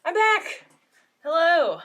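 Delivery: loud, high sing-out greeting; announcing a return. Real, not acted.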